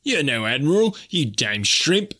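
surly retort. Acted. Sailor sneering back at Snipes, lightly surly. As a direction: snap back with contempt, slowing on the insult. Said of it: "retorted one of the men in a surly tone".

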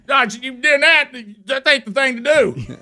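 high, slow, scolding dad; stern father voice. Retold or imitated. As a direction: scold the son like a strict father.

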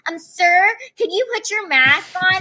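high, timid customer-service voice; lightly voiced nervous barista asking nicely; polite request. Barista to customer, mild timid ask. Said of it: "And she's like".

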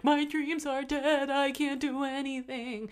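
high, drawn-out mock wail; mock despair. People hitting thirty giving up, mock despairing. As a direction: whine it in exaggerated hopelessness. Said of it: "they're like".

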